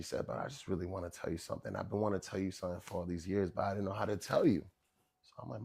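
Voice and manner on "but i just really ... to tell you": low, soft, quickened murmur; hushed lead-up to a secret; hesitant confiding mother. Retold or imitated. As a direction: imitate a mother's private confession. Mother confides privately to son.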